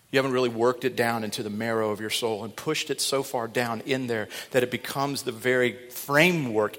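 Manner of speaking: ordinary talk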